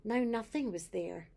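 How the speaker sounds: plain reading voice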